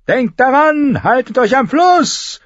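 high and loud, slowing on the final order; shouted command. A narrator's reading. Tyrion ordering his clansmen to keep to the river, shouted. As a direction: bellow the order over the charge. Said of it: "rief er seinen Stammesbrüdern im Reiten zu".

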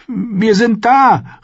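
high, loud, slow call. Acted. Arya calls to Clegane at the castle gate, loud slow hopeful call. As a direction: call out in desperate hope. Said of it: "rief sie".

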